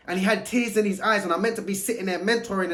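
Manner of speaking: ordinary talk, nothing marked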